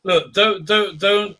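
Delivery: ordinary talk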